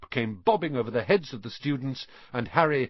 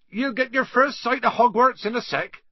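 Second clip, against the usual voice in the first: high, loud, projected call; calling over shoulder; 14 semitones higher, 6 dB louder than usual. A narrator's reading. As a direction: call back to those following behind. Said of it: "Hagrid called over his shoulder".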